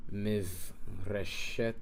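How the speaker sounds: Mivreshet said slowly, each syllable drawn out; slow pronunciation. Shown as a demonstration. Teacher modelling the word Mivreshet, slow. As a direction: stretch Mivreshet out slowly for learners. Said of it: "You have Mivreshet".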